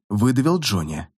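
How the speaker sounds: ordinary delivery, nothing marked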